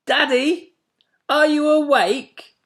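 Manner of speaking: put-on high, loud child's call; small owl child calling loudly; loud little owlet. A narrator's reading. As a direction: wake daddy up, loud and high. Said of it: "he said loudly".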